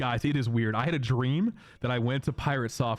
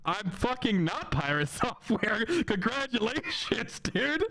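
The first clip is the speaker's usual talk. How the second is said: exasperated retort; 11 semitones higher, slower than usual. Retold or imitated. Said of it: "And I'm like".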